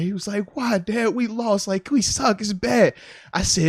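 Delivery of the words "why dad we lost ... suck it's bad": put-on higher-pitched kid complaint; upset little son. Son lamenting the loss to dad, mock upset. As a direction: lightly imitate a child protesting, pitched up.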